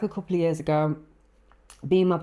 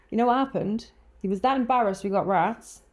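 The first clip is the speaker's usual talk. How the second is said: ordinary talk, nothing marked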